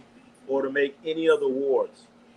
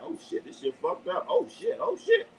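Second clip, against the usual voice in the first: retold pained panic; 5 dB quieter than usual. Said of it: "starting something like".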